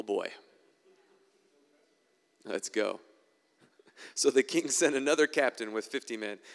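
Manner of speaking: ordinary talk, nothing marked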